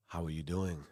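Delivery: quiet throwaway how are you; low, soft greeting. Shown as a demonstration. A host greeting viewers, low and soft. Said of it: "laughs at his own flat greeting".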